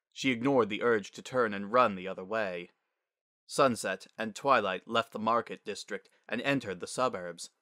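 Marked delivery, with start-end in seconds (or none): none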